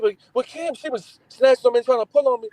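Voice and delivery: put-on high, whiny childish excuse; high pleading kid. Boy begging dad, blaming his sister Kim. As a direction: plead your case like a scared boy. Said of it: "I said".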